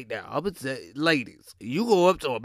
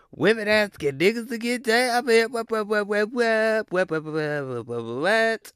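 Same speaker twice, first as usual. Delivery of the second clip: higher, rattled-off whats; rapid disbelief; 7 semitones higher than usual. Real, not acted. Watching women propose online, stuttering disbelief. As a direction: stammer the whats in shock. Reacting to social media clip.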